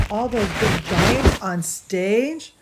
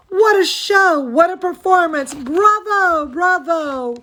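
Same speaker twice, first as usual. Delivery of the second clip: excited cheering; 8 semitones higher than usual. Acted. Audience cheering in story.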